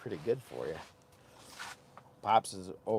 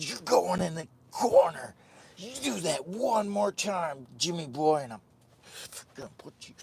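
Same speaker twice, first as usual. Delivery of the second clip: put-on higher, louder scolding old man; imitating Pops scolding; 6 semitones higher, 5 dB louder than usual. Pops scolding Jimmy for acting up, mock scold. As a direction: scold like an exasperated dad. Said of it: "He said".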